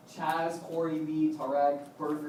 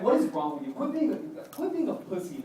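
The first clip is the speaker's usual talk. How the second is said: louder start, jumping higher on the goading; mock exasperation; 7 semitones higher, 3 dB louder than usual. Friend goading him over turning down a girl, mock exasperation. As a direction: imitate a friend's exasperated ribbing.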